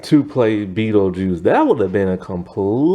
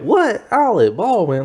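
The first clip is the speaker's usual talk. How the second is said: surprised disbelief; 10 semitones higher than usual. Retold or imitated. Researching the cast, stunned that it's Alec Baldwin. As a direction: pitch jumps high on "What?", then falls in disbelief. Researching who played the husband.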